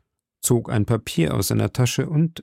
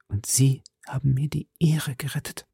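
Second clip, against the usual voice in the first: breathless whisper of a plea; 3 semitones higher, 5 dB quieter than usual. A narrator's reading. Prisoner whispers her final plea to Felton. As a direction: drop to a whisper on the last promise.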